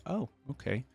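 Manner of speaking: usual speaking voice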